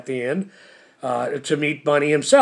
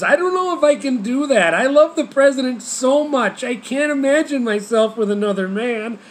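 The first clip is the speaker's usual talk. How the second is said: much higher, lightly voiced fretful wife; mock devoted wife; 8 semitones higher than usual. Bunny tells Prince she only loves the president, mock high wife voice. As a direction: play the loyal wife, pitch way up.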